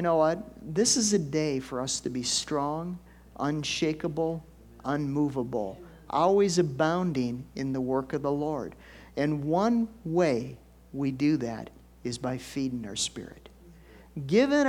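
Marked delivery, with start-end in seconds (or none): none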